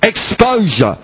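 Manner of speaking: high, intoned, almost sung preaching over music; full-force preaching. Acted. Preacher crying out Christ's last words to the church, intoned and high. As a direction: soar into a chanted sermon climax.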